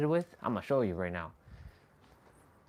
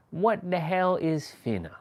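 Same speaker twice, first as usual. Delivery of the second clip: baffled; 7 semitones higher than usual. Retold or imitated. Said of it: "I'm like".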